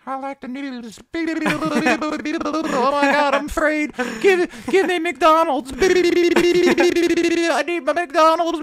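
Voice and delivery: put-on very high, loud, slow babyish wail; hammed-up baby voice begging for food; mock childish whine. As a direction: play a whiny toddler demanding McDonald's. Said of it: "all I'm showing you is".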